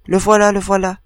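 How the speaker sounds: the crowd sights the condemned man, shouting. A narrator's reading. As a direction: call it out like a mob spotting him. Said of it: "a crié la foule".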